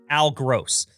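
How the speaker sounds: ordinary talk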